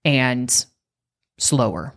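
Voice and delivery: slow, drawn-out words with wide gaps. Shown as a demonstration. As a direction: stretch each word right out. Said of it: "So slower".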